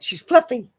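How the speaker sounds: fluffy lifted high and loud; she's FLUFFY, said up high with a rise; stress on fluffy. Shown as a demonstration. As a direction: correct the word fat to fluffy. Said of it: "She's not fat".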